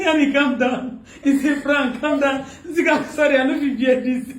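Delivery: words broken up by laughter; calm her down while cracking up; laughing reveal. Real, not acted. Revealing the prank to the frightened victim, laughing.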